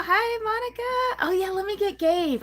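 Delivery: high, delighted hello to a regular; excited greeting. Real, not acted. Greeting a viewer who just arrived.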